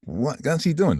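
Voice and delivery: high put-on voice, a touch of alarm; mock dad asking. Sister voicing their dad's question, high mock voice. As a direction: squeak it out. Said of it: "my sisters would say".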